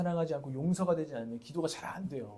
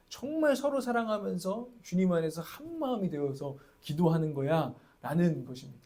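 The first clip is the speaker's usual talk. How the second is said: usual speaking voice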